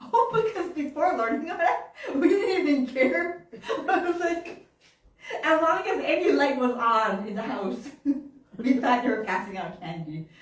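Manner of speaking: talking through laughter; real laughter bubbling through the confession; laughing. Real, not acted. Laughing at childhood mischief.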